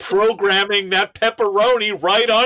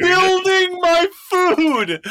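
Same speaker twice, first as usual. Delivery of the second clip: laughing mock quote; 10 semitones higher than usual. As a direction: crack up while quoting.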